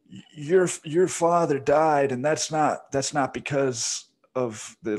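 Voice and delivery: high, slow, mock-emotional plea; mock Biden pandering. Biden looking into the camera at the audience, mock tearful pleading. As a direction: ham up a politician tugging heartstrings. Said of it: "looks into the camera and he's like".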